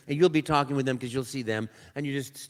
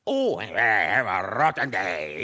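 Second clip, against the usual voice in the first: lower, slow, grumbling villain; Wario voice; 7 semitones lower, much slower than usual. Acted. Actor voicing Wario for the first time, lower slow grumble. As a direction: sulk out a rotten-day complaint as a nasty rival. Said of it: "Put a voice to this guy".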